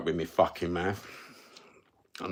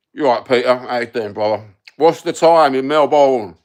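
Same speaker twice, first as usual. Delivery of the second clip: louder hearty hello to a mate; loud greeting; 2 semitones higher, 8 dB louder than usual. Real, not acted. Greeting his mate Peter in Australia, loud and hearty. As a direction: call out cheerily to Peter.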